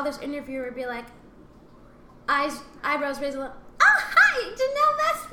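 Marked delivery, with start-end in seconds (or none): 3.8-5.3 high, loud, laughing fake cheer; mock surprised greeting. Interviewer startled meeting her in person, high loud mock cheer. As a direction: imitate a startled interviewer putting on a bright hello.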